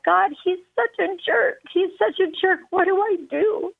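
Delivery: praying through tears; choked up. Real, not acted. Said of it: "it gets me all choked up".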